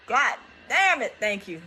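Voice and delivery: normal talking voice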